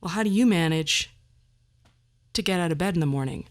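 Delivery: ordinary delivery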